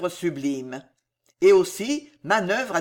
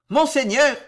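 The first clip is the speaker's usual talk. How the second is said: loud, raised cry to his lord; roaring; 6 semitones higher, 6 dB louder than usual. A narrator's reading. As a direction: call out in a high, loud roar. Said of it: "rugit la Trémouille".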